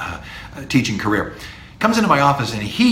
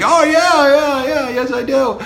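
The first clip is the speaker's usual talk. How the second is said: much higher and louder, an eager yes; emphatic yes; 12 semitones higher, 4 dB louder than usual. Shown as a demonstration. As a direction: gush it like you're dying to go. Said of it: "an emphatic".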